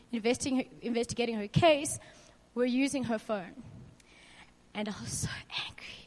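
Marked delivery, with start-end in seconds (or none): 4.7-6.0 hushed, rushed whisper; a real choked whisper; quiet. Real, not acted. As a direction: breathe it out barely voiced. Grief-laden testimony, breaks down.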